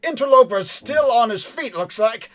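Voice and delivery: loud, high-pitched lookout's shout; yelled report. Acted. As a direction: call out a warning to your comrades. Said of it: "he hears yelled coordinations".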